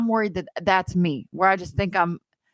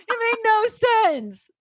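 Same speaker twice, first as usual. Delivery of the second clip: high, drawn-out cry through laughter; slow high laughing wail; laughing exclamation; 13 semitones higher, much slower than usual. Real, not acted. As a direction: burst out, stretched and incredulous. Exclaims laughing at own story.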